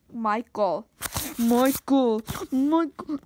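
building from loud to very loud; hammed-up scolding yell; mock angry calling. Acts out calling Michael angrily.

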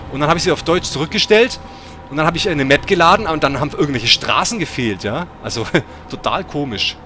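ordinary delivery

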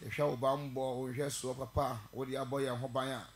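soft, low prayer voice. Real, not acted. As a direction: pray quietly and humbly before the sermon. Opening prayer, quiet and low.

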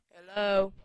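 loud hello to get a response; insistent call. Real, not acted. Player calling to a stalled game, loud and insistent. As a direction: call out sharply to something not answering.

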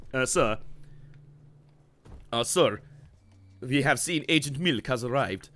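hesitant underling in a bad Russian accent; terrible Russian accent. Henchman reporting Agent Milk to HQ, bad Russian accent. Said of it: "This, uh, terrible Russian accent".

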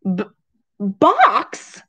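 flabbergasted, squeaked question; startled exclamation. A narrator's reading. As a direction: stumble on the word in alarm. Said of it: "exclaimed Missus Rogers".

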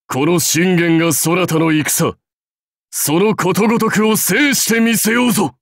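次第に高まり叫びに至る誓い; 武将として勝利を声高に誓う; 高らかな宣言. Acted. 出陣の誓いを高らかに宣言. (高らかに鬨の声へ)

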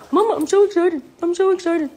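high, loud puppy; high-pitched, eager talking pet; excited dog voice. Pretend. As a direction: squeal it as an overjoyed dog to its owner. Dog answers its owner.

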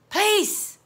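ordinary delivery, nothing marked

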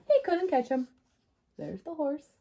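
usual speaking voice

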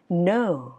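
"No" long, gliding down; English up-down glide. Shown as a demonstration. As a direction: slide "No" gently up and down. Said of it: "mà là da da". (đọc "No" lên rồi xuống)

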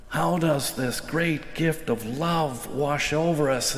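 ordinary talk

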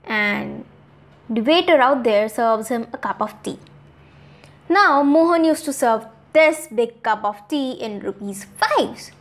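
plain reading voice

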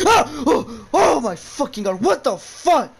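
loud panicked yelling, pitch shooting up; startled scream. Real, not acted. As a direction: shriek in real fright at the jump scare. Jump scare in horror game.

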